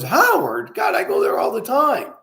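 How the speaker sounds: "Howard?" repeated as a mild surprised question; surprised recognition. Retold or imitated. Patient recognizes the owner's name, mildly surprised. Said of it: "He goes".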